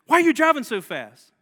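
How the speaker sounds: mock the wife's sharp complaint; scolding wife.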